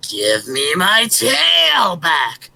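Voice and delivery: slow drawn-out howl; spooky monster shout. Acted. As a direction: drag it out like a beast in the dark. Creature calling from the woods.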